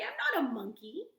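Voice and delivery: ordinary delivery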